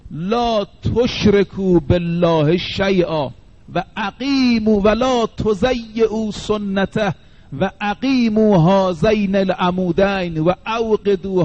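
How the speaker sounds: very slow, drawn-out Arabic recital; slow solemn recital. Acted. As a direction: chant each clause of the testament slowly. Ali's testament recited in Arabic.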